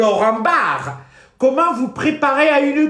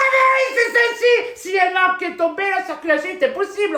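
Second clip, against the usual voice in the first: mock outrage; 7 semitones higher than usual. Mocking the government guide to Robert, a high sarcastic shout. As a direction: shriek it as if the obvious is a revelation.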